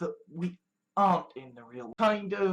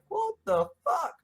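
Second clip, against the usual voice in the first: baffled; 12 semitones higher than usual. Real, not acted. Reacting to fans greeting him.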